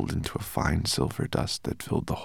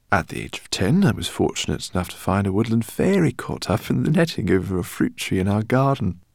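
put-on high, loud, fussy old scholar; eccentric professor; 5 semitones higher, 7 dB louder than usual. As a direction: voice him as an excitable professor. Professor speaks in story.

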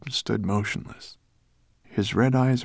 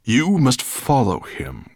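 slow, loud, heavy command; forceful dragon's grunt; grunted order; 6 semitones higher, 7 dB louder, slower than usual. A narrator's reading. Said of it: "grunted Nettlebrand".

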